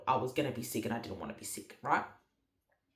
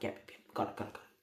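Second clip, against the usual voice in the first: very soft, whispered imitation of husband; hushed compliance; 7 semitones lower, 11 dB quieter, much faster than usual. Husband agreeing to get the stuff, imitated whisper. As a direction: whisper quick repeated agreement. Voices husband's quiet replies.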